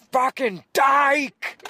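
high, loud, drawn-out yell from a passing car; yelled slur. Retold or imitated. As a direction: hurl it out long and loud. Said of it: "someone drives by and is like".

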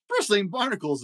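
high, startled cry; shocked exclamation. Acted. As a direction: blurt it out in alarm. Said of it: "exclaimed the lobster".

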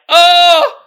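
high, loud excited shout; mock gushing fan. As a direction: gush over a favourite comic. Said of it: "They be like".

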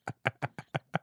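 breathy voiceless laugh; silent laugh. Real, not acted. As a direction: laugh with no voice, just air. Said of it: "We're both silent laughers".

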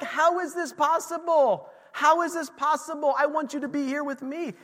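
high pleading protest; mock dismay. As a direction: plead like a bewildered disciple. Disciples protest Jesus leaving.

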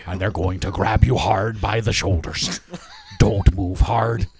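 low, intense action-hero menace; a hint of a vigilante calm threat; lightly done Liam Neeson. Mild Liam Neeson voice threatening a captive. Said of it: "Get us some more Liam Neeson".

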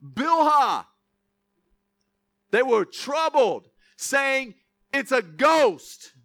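emphatic reading, key words drawn out slowly; preaching scripture. Acted. Preacher reads disciples' fright to the church, emphatic preaching. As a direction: read it out loud to the room, stretching the key words.

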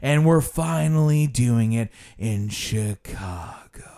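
slow, drawn-out mock guide; imitating a guide stretching for time; running out the clock. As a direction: drag the place name right out. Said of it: "That's called running out the clock".

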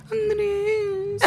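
high whiny wife voice, near tears; man doing a woman's voice. Retold or imitated. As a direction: wail the name like a tearful wife. (hombre imitando voz de mujer llorosa)

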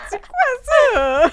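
high, loud and slow, half laughing; real disbelief, drawn out loud; incredulous shout. Real, not acted. Asking friends what those lyrics were, shouting in disbelief.

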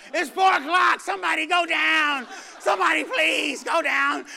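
loud, high, pleading yell; desperate shout. Retold or imitated. Congregant begging for a convert so church can end, shouting. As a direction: beg the whole church to go down.

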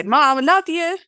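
put-on high, loud child's Mum, I love you; young child calling to Mum; child pleading. Child telling mother love.

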